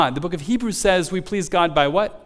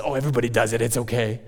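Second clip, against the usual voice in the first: excusing voice; 8 semitones lower, 4 dB quieter, much faster than usual. Pretend. Voices the flesh excusing sin.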